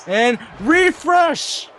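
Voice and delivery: high, slow drawn-out shout; excited shout. Real, not acted. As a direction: stretch the call out high. Commentator calls the finisher.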